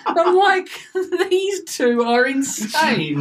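high-pitched, breaking up with laughter; helpless laughing retort; laughing disbelief. Retold or imitated. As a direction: squeal it through laughter in mock disbelief. Laughing while retelling own reaction.

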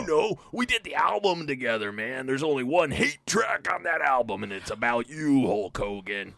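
lightly voiced wrestler rasp; a hint of Macho Man grudge; Randy Savage impression. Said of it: "And he's like".

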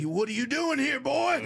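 high menacing southern drawl; a creepy Louisiana local, high and loud; southern accent. Retold or imitated. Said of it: "everybody has a southern accent".

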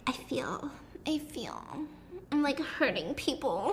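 hushed, whispery reading of a heroine; mock first-person narrator. Host sends up the book's first-person heroine, mock fragile. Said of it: "it's written in first person".

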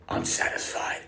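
hushed smug repeat; whispered smug. Retold or imitated. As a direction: breathe it out smugly. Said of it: "he goes".

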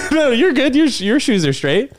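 laughing high and bright. Real, not acted. Reassuring the guest between laughs, high laughing voice.

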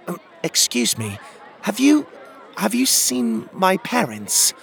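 put-on high-pitched, hesitant little boy asking a stranger; small, high, uncertain child's voice; hesitant young boy. A narrator's reading. Young boy asks strangers about parents.

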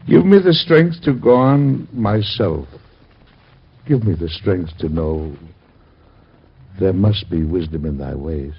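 grieving father praying for strength; prayer. Acted. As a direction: beg God for strength. Father's prayer over son.

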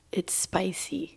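slow, soft whisper; hoarse spicy-tea whisper; lost voice. Real, not acted. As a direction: whisper it, voice gone. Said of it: "se me fue la voz".

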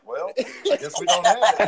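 retold reply breaking into laughs; laughing punchline. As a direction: deliver the quote while cracking up. Punchline told through laughter.

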